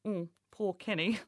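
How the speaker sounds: normal talking voice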